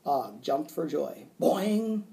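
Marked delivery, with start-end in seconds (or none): 1.4-2.0 high, springy twang; spring it out in one bounce; bouncy frog boing. A narrator's reading. Frog jumping for joy, bouncy boing. Frog's noise as Og jumps.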